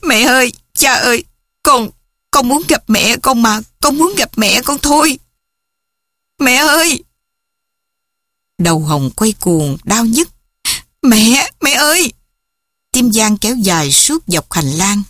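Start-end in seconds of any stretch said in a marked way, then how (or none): 0.0-7.0 put-on high, pleading child's wail; distraught little girl crying out. A narrator's reading. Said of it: "Hồng ngã đầu lên vai cha gào khóc". 11.0-12.1 high, desperate cry for mother; frightened child's call; screaming. A narrator's reading. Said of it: "Hồng hét".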